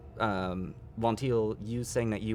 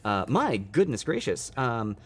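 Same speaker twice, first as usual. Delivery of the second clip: ordinary delivery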